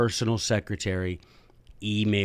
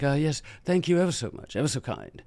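light prim English thanks; mock posh British; 4 semitones higher, 3 dB quieter than usual. Teacher parodying British courtesy, mild posh accent. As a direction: play the overpolite Brit, softly. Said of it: "very British and polite".

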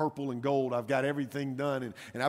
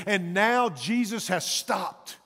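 raised, driving sermon delivery; impassioned preaching; 7 semitones higher, 6 dB louder, slower than usual. Acted. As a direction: preach it with mounting urgency.

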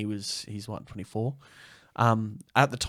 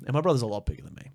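ordinary talk, nothing marked

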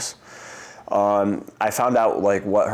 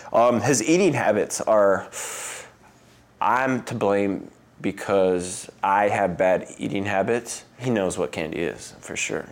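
usual speaking voice

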